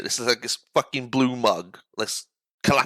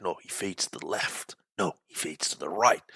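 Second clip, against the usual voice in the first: muttering to himself; 4 semitones lower, 8 dB quieter than usual. Retold or imitated. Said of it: "thinking of a play".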